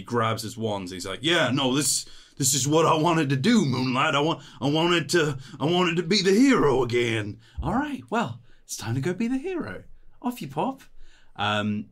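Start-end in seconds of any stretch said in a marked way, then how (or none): 1.2-7.4 loud, high, stumbling declaration; fervent dazed hero. Acted. Hero declares his wish, rousing himself. 7.6-10.8 high-pitched send-off; coaxing companion. Acted. Companion briskly sends the hero off.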